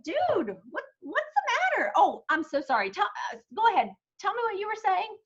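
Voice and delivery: high, fast, flustered apology; startled guy. Retold or imitated. Client soaked with ice water, startled and apologizing. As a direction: sputter it out, caught off guard and apologetic. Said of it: "And the guy's like".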